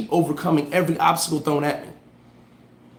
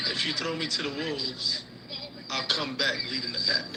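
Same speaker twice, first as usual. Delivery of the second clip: quiet voice note; 3 semitones lower, 11 dB quieter than usual. Shown as a demonstration. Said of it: "I left a voice note".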